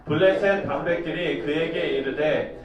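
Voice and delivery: measured solemn Bible reading; formal verse recitation; scripture reading. Acted. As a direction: read it out to the congregation. Reads scripture aloud.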